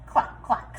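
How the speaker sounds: breathy whispered clucks; hen clucks. Acted. Chicken answers Duck.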